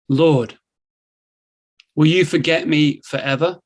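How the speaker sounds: ordinary talk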